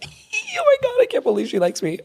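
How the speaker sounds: very high, giddy mock squeal; retold fangirl excitement; starstruck squeal. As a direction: squeal like a thrilled fan, easing down. Retelling starstruck reaction to compliment.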